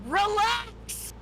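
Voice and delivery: retold dad yell. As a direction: bark relax at the baby, hammed-up. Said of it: "would just start yelling".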